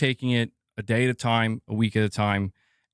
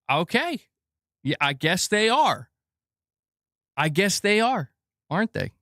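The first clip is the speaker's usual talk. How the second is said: higher, mock-resigned agreement; dry mock agreement with a laugh in it; sarcastic concession; 6 semitones higher than usual. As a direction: play along sarcastically, eyebrows up. Sarcastic reply to Sony claim.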